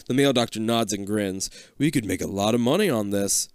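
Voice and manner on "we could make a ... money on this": ordinary talk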